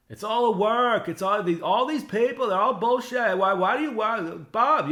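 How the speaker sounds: high, loud furious ranting impression; Kevin Brennan impression. Mocking the host berating Bob on air, high loud rant. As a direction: yell it out like a host who thinks everyone's a fraud. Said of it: "that's my Kevin Brennan impression".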